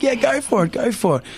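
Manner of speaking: high, mock-casual encouragement; breezy go-ahead. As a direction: toss off the permission, lightly teasing. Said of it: "I said".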